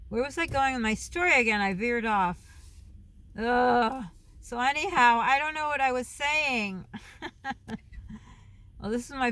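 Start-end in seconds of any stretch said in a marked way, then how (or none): 3.4-4.0 loud drawn-out ugh; exasperated groan. Real, not acted. Vlogger who veered off her story, exasperated groan. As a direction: groan at losing your train of thought.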